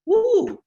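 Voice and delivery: high, loud whistling woo; a warning whoop at the trouble to come; mock woo. Lava finding out about coffee.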